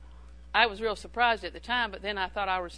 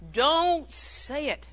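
slow emphatic warning; 5 semitones higher, 5 dB louder, much slower than usual. Real, not acted.

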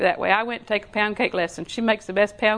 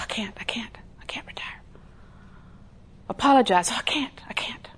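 whispered refusal; 5 dB quieter than usual. Retold or imitated. Resisting God, refusing quietly.

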